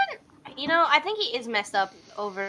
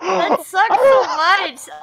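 slow, loud laughing shout; 7 semitones higher, 8 dB louder, much slower than usual. Real, not acted. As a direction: groan it out through laughter. Reacting to sad dog story.

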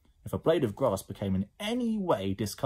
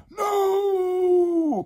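very high, loud, long wail; despairing cry; 8 dB louder than usual. Acted. Twins dread a hundred slime children, despairing cry. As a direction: wail it out in horror. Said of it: "cried the pair of twins".

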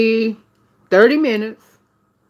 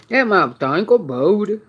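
ordinary talk

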